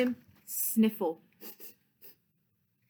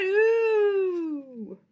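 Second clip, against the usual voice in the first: pig sound; 8 semitones higher, 4 dB louder than usual. Acted. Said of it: "and he said".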